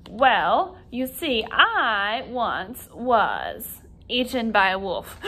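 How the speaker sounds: slow, drawn-out character voice; slow puppet voice. Acted. Puppet Robert telling his story slowly in a put-on voice. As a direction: deliver the shock line slowly as the puppet. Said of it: "this is Robert".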